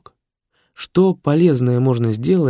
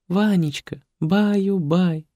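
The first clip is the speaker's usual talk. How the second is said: lullaby; 9 semitones higher, slower than usual. Acted. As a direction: sing him to sleep tenderly. Caring program sings lullaby.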